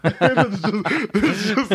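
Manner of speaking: words breaking up in high laughter; laughing. Real, not acted. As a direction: try to speak through a laughing fit. Cracks up at own saying.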